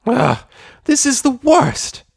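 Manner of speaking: high, loud, slow whine; exasperated wail. A narrator's reading. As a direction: wail it out dramatically. Rainbow Dash despairing.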